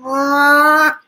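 loud, high, sustained "ah"; one long loud cry of exasperation; exasperated yell. Real, not acted. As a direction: yell out in frustration. Exasperated by the inspect animation.

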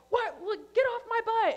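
high, loud retold road rage; yelling. Driver to the guy behind her, yelling. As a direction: snap and holler at a tailgater. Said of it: "Yelling at him".

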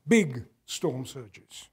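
slow stress on big. Real, not acted. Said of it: "repeated, slower".

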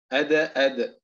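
أد، أد with a strong echo on the d; أد، أد with a full qalqala release; stronger qalqala. Shown as a demonstration. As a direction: bounce the final د of أد hard, twice. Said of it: "التمييز بين مراتب القلقلة".

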